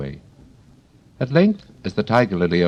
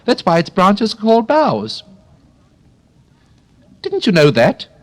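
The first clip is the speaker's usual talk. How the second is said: high, loud little flower cry; a tiny flower shouting up; shrill daisy cry; 6 dB louder than usual. Acted. A daisy cries out to Alice, high and loud. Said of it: "cried another daisy".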